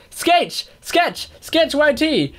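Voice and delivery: shrieked high, stretching out Sketch; high-pitched fangirl cry of Sketch; mock excited shout. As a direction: squeal the name like an overeager fan. Reading a fan's mangled name.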